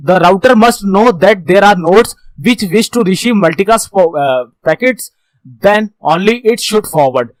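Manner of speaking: reads each English word very slowly; slow slide reading. Shown as a demonstration. Teacher reading the slide to students, very slow and deliberate. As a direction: dictate the slide line slowly to the class.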